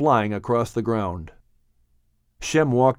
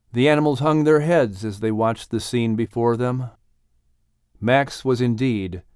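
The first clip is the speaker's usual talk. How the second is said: plain reading voice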